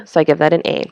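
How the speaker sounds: ordinary talk, nothing marked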